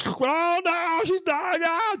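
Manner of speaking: very high, slow, strangled little voice; dying bird voice. Pretend. Voicing the struggling bird, very high and slow. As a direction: squeak it out like a bird in its last moments. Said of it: "That's like".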